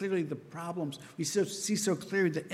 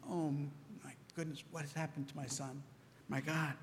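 hushed dread; 9 dB quieter than usual. Retold or imitated. Father fearing son is dead.

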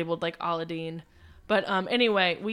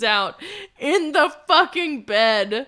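slow, louder words through laughter; laughing; 5 semitones higher, 6 dB louder, slower than usual. Real, not acted. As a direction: laugh while finishing the story. Laughing at the story's end.

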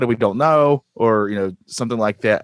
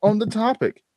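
put-on Australian accent; 8 semitones higher than usual. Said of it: "and they go".

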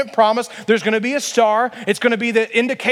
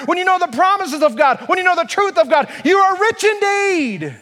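high, rising preacher's crescendo; impassioned preaching; 6 semitones higher than usual. Real, not acted. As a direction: drive each phrase higher like a revival preacher. Preacher builds to a climax.

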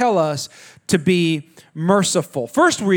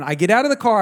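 ordinary talk, nothing marked

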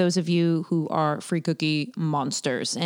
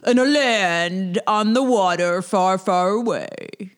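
imitating a trailer voice; slower than usual. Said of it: "and that was the voice".

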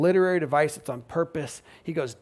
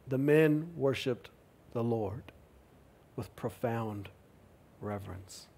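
hushed reverence; 5 semitones lower, 9 dB quieter than usual. A narrator's reading. Describing reverent worship.

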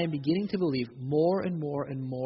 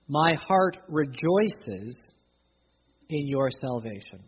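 slow reading; slower than usual. Acted. Quoting psalm slowly.

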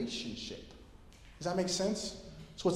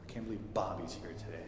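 annoyed grumble; 9 semitones lower, 11 dB quieter than usual. Retold or imitated. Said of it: "it's like".

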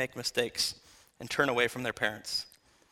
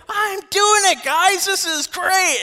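much higher and louder, bursting with glee; thrilled shout; 9 dB louder, slower than usual. Acted. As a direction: shout it out like a kid who just pulled off the impossible. Peter walking on water, thrilled.

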